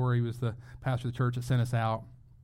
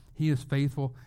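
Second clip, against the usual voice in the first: ordinary delivery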